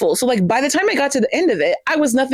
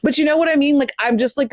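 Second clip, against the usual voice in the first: ordinary talk, nothing marked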